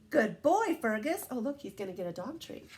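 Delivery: ordinary delivery, nothing marked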